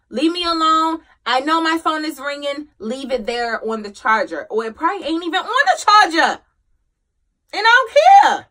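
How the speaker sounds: irritated, building to a high, loud outburst; fed-up brush-off. Retold or imitated. Depressed mom wanting to be left alone, fed-up brush-off.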